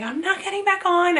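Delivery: high, tearful no; retold bawling refusal. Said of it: "I started bawling".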